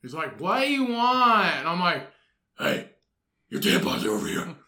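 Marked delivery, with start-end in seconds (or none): 0.4-1.5 high, slow mock whine; annoyed partner. Partner answering Batman's calls. 2.6-4.5 low, hoarse superhero rasp; Batman. Acted. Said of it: "I get my best Batman voice".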